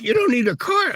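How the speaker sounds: mock the banker's shock; shocked disbelief. Bank shocked at no car.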